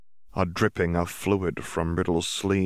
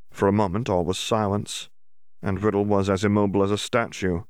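ordinary delivery, nothing marked

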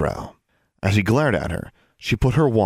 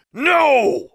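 loud, high-pitched roar; roared refusal; 5 dB louder than usual. A narrator's reading. Brother refusing to let his sister come along, roaring. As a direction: roar it out to cut them off. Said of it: "he roared".

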